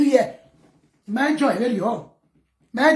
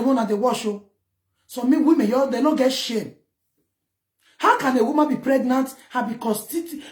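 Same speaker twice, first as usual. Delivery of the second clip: normal talking voice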